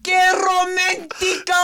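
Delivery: slow, rolled dramatic Spanish; overacted Spanish flourish; Spanish accent. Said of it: "Oh, we're Spanish now, huh?".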